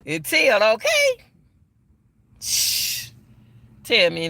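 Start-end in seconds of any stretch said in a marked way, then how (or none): 0.1-1.2 put-on high childlike voice, rising on okay; little girl's voice. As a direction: imitate a chatty six-year-old.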